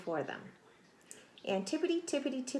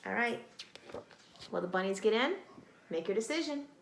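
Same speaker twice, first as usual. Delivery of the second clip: plain reading voice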